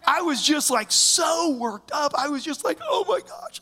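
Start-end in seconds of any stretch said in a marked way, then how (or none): 2.8-3.6 exasperated oh my gosh. Retold or imitated. Overwhelmed, worked up.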